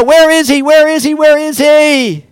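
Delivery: high, loud, pleading and slowing; frantic searching shout; desperate repeated cry. Acted. Mary asking for Jesus repeatedly.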